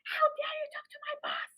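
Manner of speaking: very high, thin squeal of indignation; mock outrage. Worker defending the boss against a customer, squealed mock outrage. Acted outburst at rude customer.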